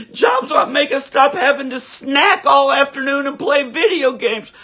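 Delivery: high, loud shrieking tantrum, laughing through it; mocking whiny liberal. Ranter mocking opponents with a high, shouted whine. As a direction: play a spoiled brat screaming about jobs.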